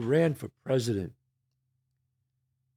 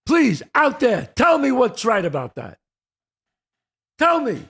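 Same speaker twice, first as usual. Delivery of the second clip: louder, heated demand to the audience; getting angry; 5 semitones higher, 6 dB louder than usual. Real, not acted. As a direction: challenge them, voice rising in anger. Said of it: "I'm getting pissed off now".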